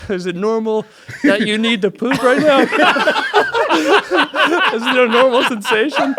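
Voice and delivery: can barely get it out for laughing; embarrassed question dissolving into laughter; laughing retold question. Retelling asking nurse about pooping.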